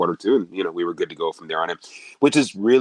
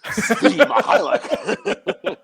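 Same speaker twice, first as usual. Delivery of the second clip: long, stretched-out name call, much higher; arena announcer call; 5 dB louder, much slower than usual. Shown as a demonstration. Announcer calling player name.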